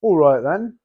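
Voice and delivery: hammed-up British "All right then"; playful British accent, deliberately repeated; mock British accent. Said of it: "I just sounded British right there".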